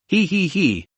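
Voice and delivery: slow sly snicker; a slow menacing hee hee; evil giggle. Acted. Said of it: "said with a bad smile".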